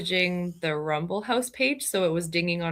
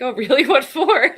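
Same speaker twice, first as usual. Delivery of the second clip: mock-surprised, laughing, pitched up; laughing mock surprise; 6 semitones higher, 8 dB louder than usual. Wife quoting her husband to the scammer, laughing. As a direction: play innocent while cracking up.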